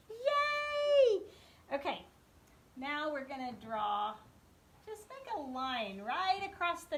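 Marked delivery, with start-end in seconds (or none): 0.1-1.2 high loud praise cheer; excited cheer. Real, not acted. Praising child's square.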